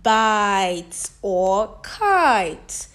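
slow, loud vowel demo; slow stretched words. Shown as a demonstration. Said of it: "as in".

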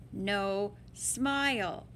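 usual speaking voice

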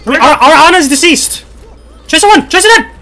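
high, loud rapid-fire shouting; hyped-up gamer yelling; excited callouts. Real, not acted. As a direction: shout the updates to your team. Excited kill callouts in game.

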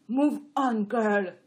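usual speaking voice

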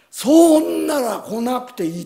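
loud sharp comeback; angry retort. Retold or imitated. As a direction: throw it back in anger. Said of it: "売り言葉に書いて".

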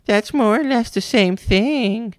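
high, slow mocking parrot of a boy; mocking mimicry. A narrator's reading. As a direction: parrot the boy back cruelly. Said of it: "Nero mimicked".

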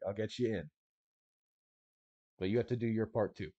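a hint of a no-nonsense smuggler laying down rules; lightly voiced stalker guide, matter-of-fact; gruff guide Coyote. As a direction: tough guide briefing his clients, half-acted. GM plays Coyote briefing group.

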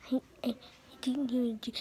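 ordinary talk

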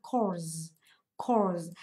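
"cause" ending in a voiced z; final z sound. Shown as a demonstration. Teacher showing the class the root word, "cause" ending in z. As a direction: draw out "cause" into a buzzing z. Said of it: "phiên âm của chúng ta đó chính là chữ gì".

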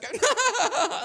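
high, loud burst of laughter. Real, not acted. Comedian laughs out loud at his own punchline.

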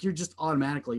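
ordinary talk

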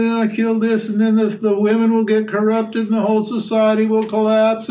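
high, whining pleading voice; Arjuna whining. Retold or imitated. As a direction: whine out every excuse not to fight. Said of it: "And Arjuna said".

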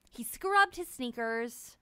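ordinary delivery

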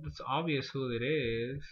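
usual speaking voice

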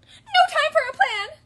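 very high, loud frantic squawk; puffing panicked birds. A narrator's reading. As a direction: puff it out in a panic. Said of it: "they puffed".

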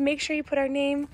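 normal talking voice

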